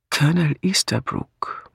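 "Colonel Easterbrook" breathed in a soft whisper; murmured. Acted. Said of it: "murmelte Craddock".

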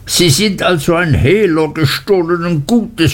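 a cornered man lashing out, pitched high; furious shout. A narrator's reading. Uriah accusing Traddles of receiving stolen goods, high furious shout. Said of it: "schrie Uriah".

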